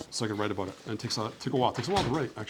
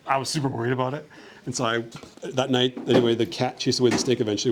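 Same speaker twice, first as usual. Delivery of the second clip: usual speaking voice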